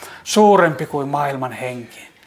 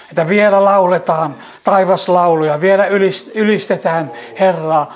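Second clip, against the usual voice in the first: raised, louder and higher, swelling praise; fervent praise; 4 semitones higher, 4 dB louder than usual. Real, not acted. Praying for the weary, rising into fervent, louder praise. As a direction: let the prayer swell into ringing praise.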